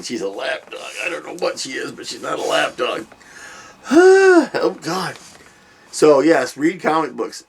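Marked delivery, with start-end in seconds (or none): 3.9-4.5 heavy sigh. Real, not acted. Dog settles on lap.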